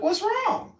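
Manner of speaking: high concerned voice. Retold or imitated. Himself asking the fallen man.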